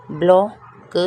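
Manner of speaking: Block said out loud with a rise; clear loud model. Shown as a demonstration. Teacher models block loudly for students, rising. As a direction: call block out clearly for the class. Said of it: "teacher models word twice".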